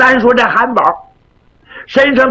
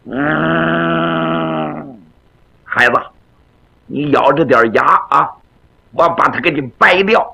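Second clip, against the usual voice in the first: 压低嗓音稳稳地叮嘱孩子; 像长辈哄孩子忍着点; 低沉安抚; 6 semitones lower, slower than usual. Acted. 师傅低声安慰徒弟. (低声安慰徒弟咬牙忍住)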